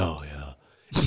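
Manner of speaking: low, soft, deflated reply; downbeat imitation. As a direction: imitate a grumpy actor with no energy.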